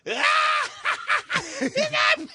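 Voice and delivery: very high, loud squealing mockery; imitating hysterical laughter. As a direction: crack up while shrieking the words. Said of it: "He's laughing".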